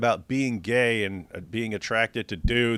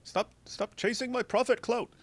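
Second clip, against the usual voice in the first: high squeaky comic prophet voice; mock prophet scolding; 11 semitones higher, 3 dB quieter than usual. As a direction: scold them in a silly high voice. Voicing Muhammad scolding his followers.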